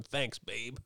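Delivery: low, soft voice of a cartoon cat; lightly voiced suave cat, kept quiet; Tim Curry. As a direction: murmur it low as an impression. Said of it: "Tim Curry going".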